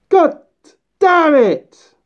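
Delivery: ordinary talk, nothing marked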